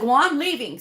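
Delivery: mock huffy exit line, high and loud; getting mad. Coder retelling the doctor walking out on her, mock mad. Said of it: "he starts getting mad".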